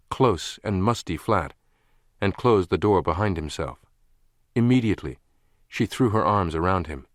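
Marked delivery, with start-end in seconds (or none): none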